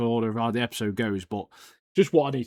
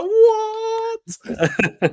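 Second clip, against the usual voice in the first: a long, sky-high drawn-out what; imitating a courtroom gasping in disbelief; stunned what. Crowd in the courtroom reacting to the reveal, stunned high what. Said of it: "And everyone's like".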